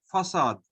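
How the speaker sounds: 'facade' said fa-SAHD, C as S; C pronounced like S in 'facade'; soft C. Shown as a demonstration. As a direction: say 'facade' with an S sound in the middle. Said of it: "Like the word in English".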